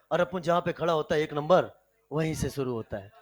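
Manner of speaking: low, menacing swagger of a Bombay don; don tough guy. Acted. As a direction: growl it like a street don boasting. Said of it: "कहता है".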